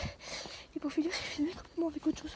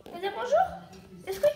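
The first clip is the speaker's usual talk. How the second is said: much higher, louder sing-song call; coaxing pet talk; 9 semitones higher, 8 dB louder than usual. Real, not acted. To her pet, urging it to say hello: high loud coaxing call. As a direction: coax the pet out with a bright call.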